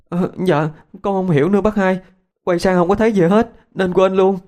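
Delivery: put-on high weepy girl's voice; crying while explaining. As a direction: sob out an explanation to the neighbour. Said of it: "Yến cũng khóc".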